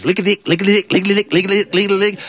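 quick panting lick-a-lick patter; dog running sound. Retold or imitated. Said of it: "it's running along behind him going".

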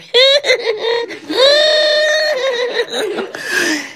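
high-pitched lovers' giggle; long, silly tittering; mock giggle. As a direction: giggle shyly at a sweetheart, high and drawn out. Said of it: "they would kinda giggle at each other".

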